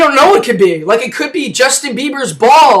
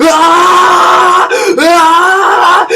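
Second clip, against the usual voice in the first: high, loud drawn-out cry; two long wailing screams; mock yells; 6 semitones higher, 4 dB louder than usual. Man on fire beating a guy, high wailing yells.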